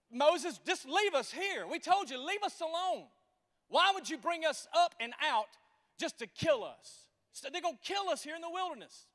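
loud, high complaining crowd; angry crowd. Acted. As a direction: shout it back at Moses, stretching "leave". Preacher voicing angry complaining crowd.

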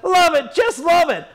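very high, loud enthusiastic holler; high-pitched acted shout; shouting back. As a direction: yell it back with comic glee.

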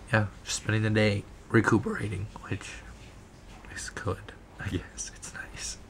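hoarse whisper, voice almost gone; lost voice whisper. Real, not acted. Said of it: "I just wish I could talk".

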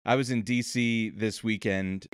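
ordinary talk, nothing marked

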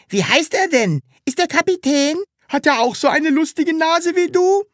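put-on high, curious kid voices; eager children. A narrator's reading. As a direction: ask like excited children. Kids ask Sams questions. (neugierige Kinderstimmen, hoch)

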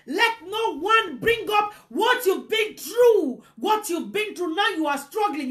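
high, urgent pulpit delivery; fiery preaching. Real, not acted. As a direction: preach it hard, driving each phrase home. Preaches at full force.

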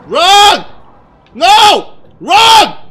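very loud, high single-word bursts; panicked shouting. Real, not acted. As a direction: scream orders mid-chase. Shouting during a chase.

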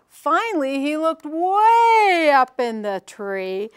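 "way" stretched long and loud, swooping up; drawn-out WAY. A narrator's reading. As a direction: stretch "way" as if the tree is towering. Storyteller stretches the word way.

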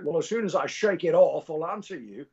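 dry retort in an imitated Scottish burr; Sean Connery. Sean Connery answering a pestering fan in the bathroom, mock-gruff impression. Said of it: "and he's like".

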